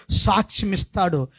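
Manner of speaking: fiery preaching. Acted. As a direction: preach it out to the congregation. Preaching rises to full force.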